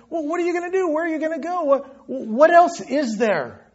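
lightly acted bewilderment, pitched high; high-pitched string of helpless questions; bewildered questioner. Preacher voices someone with nowhere else to go, bewildered.

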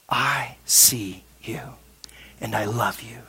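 very slow, low, intimate whisper; tender whisper. Retold or imitated. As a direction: whisper it slowly to comfort her. Jesus tenderly reassures the trembling woman.

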